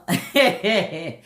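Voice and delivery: short real laugh at the husband story; a warm burst of laughter. Real, not acted. Laughing to viewers about her husband, real laugh.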